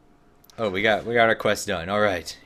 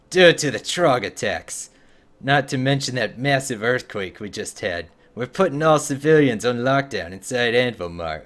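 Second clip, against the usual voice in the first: a hint of Scottish dwarf accent, a little louder; 3 semitones higher, 5 dB louder than usual. Streamer voicing a dwarf guard in a Scottish accent. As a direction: lightly roll it in a Scottish brogue. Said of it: "I'm trying to do the accent".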